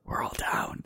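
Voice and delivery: mock conspiratorial whisper; plotting in a whisper.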